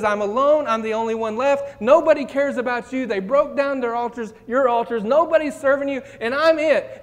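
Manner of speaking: a prophet protesting he's the only one left; exasperated complaint. Acted. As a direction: throw up your hands at God. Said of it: "Elijah says".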